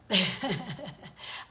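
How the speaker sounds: a quick real laugh; amused little laugh; short laugh. Real, not acted. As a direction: laugh it off lightly. Laughs at own question.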